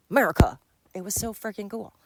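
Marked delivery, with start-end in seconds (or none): 0.1-0.6 loud, brief burst of national pride; mock patriotic exclamation. Awestruck watcher of an eagle with a snake, mock patriotic shout. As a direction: punch it out loud. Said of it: "I was like".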